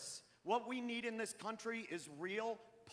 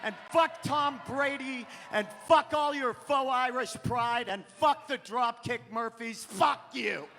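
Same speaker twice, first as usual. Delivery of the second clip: loud, high-pitched angry rant; mad ranting; 5 semitones higher, 6 dB louder than usual. Acted. Comic ranting at a crowd about Boston, mad shouting. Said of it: "Listen to how mad I am right now".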